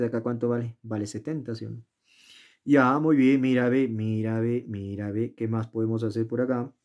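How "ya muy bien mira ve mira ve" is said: ordinary talk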